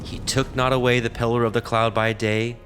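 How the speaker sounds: ordinary talk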